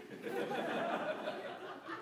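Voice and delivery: airless high-pitched giggle; a real embarrassed giggle; breathy high giggle. Real, not acted. Laughs at his own failure.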